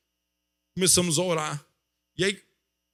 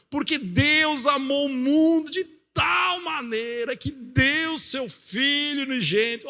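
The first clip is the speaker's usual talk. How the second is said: high, slow, shouted proclamation of the verse; preached Bible verse; 7 semitones higher, slower than usual. Acted. Pastor proclaims John 3:16 over the sick woman's husband, shouting slowly. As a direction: cry it out like the climax of a sermon.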